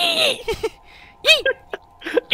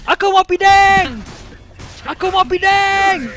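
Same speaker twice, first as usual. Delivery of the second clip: insistent shout; 8 semitones higher than usual. Real, not acted. Repeated loud demand.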